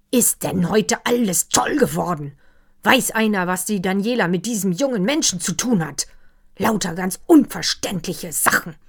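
loud, exasperated complaint; an old gentleman losing patience, loudly; exasperated outburst. Acted.

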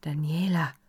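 whispered name; quiet, hoarse with excitement. A narrator's reading. Karl Erdmann whispers to Daniela in the dark. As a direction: breathe her name, barely voiced. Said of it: "sagte er leise und heiser vor Erregung".

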